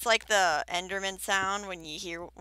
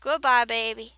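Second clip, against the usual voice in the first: teasing goodbye; 4 semitones higher, 9 dB louder than usual. Real, not acted. Waves the creeper off.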